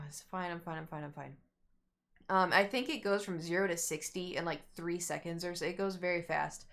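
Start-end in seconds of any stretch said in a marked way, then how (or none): 0.1-1.3 self-soothing mutter. Retold or imitated. Calming herself before the ride.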